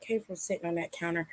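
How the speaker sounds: soft, distracted aside; muttered aside. Real, not acted. An aside while checking the oily phone case. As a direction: talk softly to yourself about the phone.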